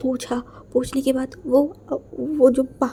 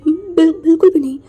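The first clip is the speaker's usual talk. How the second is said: tearful pleading; 8 dB louder than usual. Acted. Dying lover cries out to his beloved, sobbing loudly. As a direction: voice breaking loud through tears.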